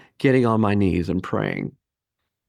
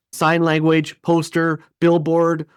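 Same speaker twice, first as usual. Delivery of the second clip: ordinary delivery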